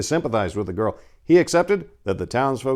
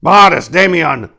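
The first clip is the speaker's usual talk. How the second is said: loud, higher urgent yell; 8 semitones higher, 13 dB louder than usual. Acted. Paladin calling his knights, yelling. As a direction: call the knights to action. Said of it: "yelled Dorn".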